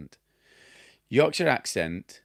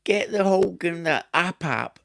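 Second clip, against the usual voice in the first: loud, high Cockney take-off; mock Cockney; 10 semitones higher, 6 dB louder than usual. Cockney plasterer ordering his mate to get the tools, mock Cockney accent. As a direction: imitate a Cockney plasterer, raised and punchy. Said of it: "your Cockney people".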